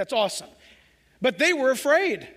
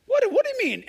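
high, startled question; shocked disciples; 12 semitones higher, 5 dB louder than usual. Acted. Disciples hearing Jesus will leave, startled. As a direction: blurt it out in alarm.

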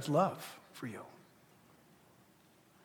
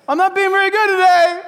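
higher, loud; sheepish confession; 11 semitones higher, 13 dB louder than usual. Retold or imitated. Believer admitting to God he's been bad, high and loud. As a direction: confess like a naughty child. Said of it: "we to God".

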